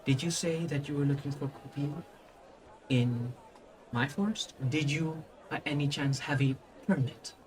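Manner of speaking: a hint of an authoritative woman, quietly questioning; lady's voice. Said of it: "the lady herself just goes".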